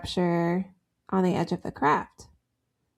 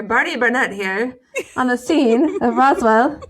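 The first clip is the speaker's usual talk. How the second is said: louder TV news reporter; 4 semitones higher, 7 dB louder than usual. Acted. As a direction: sign on like a field reporter. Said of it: "It sounds like a reporter".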